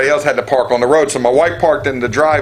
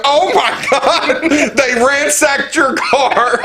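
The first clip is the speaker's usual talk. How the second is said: high, slow, laughing gasp of shock; mock horrified neighbor, much higher; 11 semitones higher, much slower than usual. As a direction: imitate her horrified disbelief, drawn out. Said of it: "she goes".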